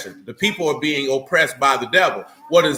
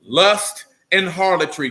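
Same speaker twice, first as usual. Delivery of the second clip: ordinary talk, nothing marked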